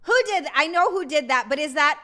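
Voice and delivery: ordinary talk, nothing marked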